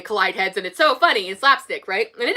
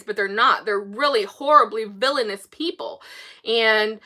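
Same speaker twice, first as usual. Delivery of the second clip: ordinary talk, nothing marked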